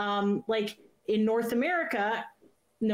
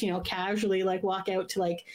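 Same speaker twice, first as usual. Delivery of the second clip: ordinary talk, nothing marked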